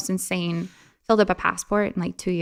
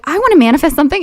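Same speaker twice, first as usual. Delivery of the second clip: put-on higher, louder excited kid; eager kid; 8 semitones higher, 9 dB louder, faster than usual. Young girl telling her mom, higher and louder. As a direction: announce it like an eager twelve-year-old. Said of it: "I was like".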